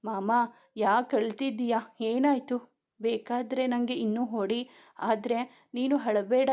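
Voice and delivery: plain reading voice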